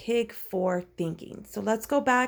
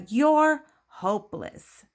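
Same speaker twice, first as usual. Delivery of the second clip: slow, higher, louder exasperation; giving up on them; 6 semitones higher, 5 dB louder, slower than usual. Acted. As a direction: draw it out as a hammed-up dismissal. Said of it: "she's, like, giving up on them".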